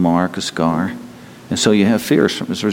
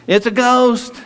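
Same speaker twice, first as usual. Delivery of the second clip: high, hammed-up spooked "it's a ghost"; mock frightened cry; 12 semitones higher, slower than usual. Disciples seeing Jesus on the water, a mock frightened squeal. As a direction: squeal "ghost" like a scared sailor.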